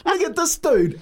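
high laughing quote; high mocking voice. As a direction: mimic a startled onlooker up high.

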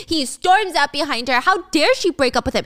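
usual speaking voice

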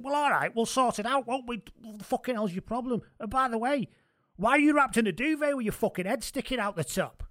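[name] voice. Retold or imitated. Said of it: "become [name]".

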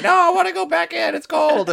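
high, fast whining dog voice; whiny dog voice. Retold or imitated. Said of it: "The dog's like".

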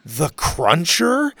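slow, rising alarmed echo; alarmed question. Acted. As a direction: ask it in sudden dread. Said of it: "the kids said in unison".

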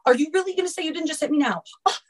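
high-pitched imitation of staged shock; mock accusing. Voicing the ex's staged accusations.

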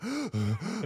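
sharp, high gasp for air. Retold or imitated. Swimmer reliving the struggle, sharp gasp. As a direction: gasp like you're out of breath. Gasping after the swim.

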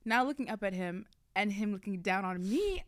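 ordinary talk

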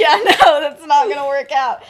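loud, laughing brush-off; laughing dismissal. Retold or imitated. Friend laughing off the idea of making up, loud and amused.

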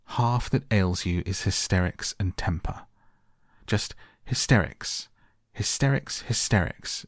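plain reading voice

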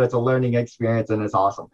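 ordinary talk

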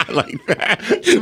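laughing while talking; words broken by laughter. Real, not acted. Laughing at story.

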